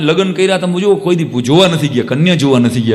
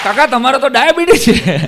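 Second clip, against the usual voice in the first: put-on girl's high teasing scold; 8 semitones higher than usual. Girl scolds diabetic uncle.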